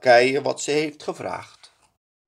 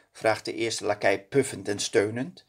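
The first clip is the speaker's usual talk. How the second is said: ordinary delivery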